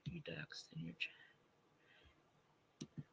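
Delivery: whisper. Real, not acted. Whispering an aside to her chat. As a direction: drop to a breathy whisper.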